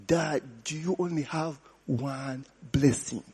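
soft, hushed imitation of a son pleading through tears; mock crying plea. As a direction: plead quietly like a weeping son, lightly played. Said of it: "the guy came crying".

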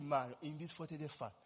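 quiet, grave divine words; God's words retold gently and solemnly. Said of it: "And God said".

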